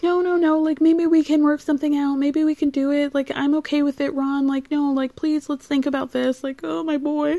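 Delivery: high-pitched mock pleading; pleading mother. Acts out Betty pleading with Ron.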